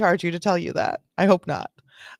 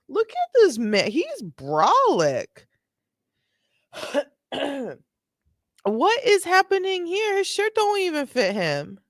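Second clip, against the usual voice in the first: higher, incredulous at the big cop; amazed disbelief; 5 semitones higher than usual. Real, not acted. Streamer gawking at the muscular cop, higher amazed disbelief. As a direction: gawk at how huge he is.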